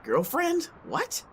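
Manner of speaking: flustered, caught off guard; surprised. Acted. Asked about his girlfriend, he yelps in flustered disbelief. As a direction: pitch leaps up in shocked disbelief.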